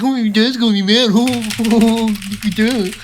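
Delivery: high, loud, hammed-up taunt breaking into laughter; exaggerated silly imitation to belittle a sibling; mocking mimicry. Brother looks over and parrots his sister's threat, mocking.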